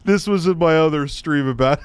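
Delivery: ordinary talk, nothing marked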